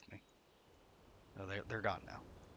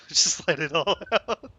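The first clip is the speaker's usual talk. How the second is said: words broken by loud laughter; laughing; 6 semitones higher, 9 dB louder than usual. Real, not acted. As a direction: laugh through it, louder. Laughing while venting.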